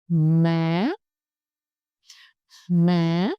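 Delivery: MA starting low, swooping sharply up at the end; rising tone. Shown as a demonstration. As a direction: hold MA low, then shoot it upward. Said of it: "start low and go up slowly and sharply".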